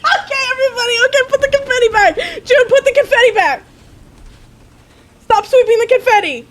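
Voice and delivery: high, loud, laughing commands; excited shouting. Real, not acted. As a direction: shout giddy orders to the whole room. Celebrating, calling out orders.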